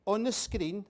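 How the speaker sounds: ordinary delivery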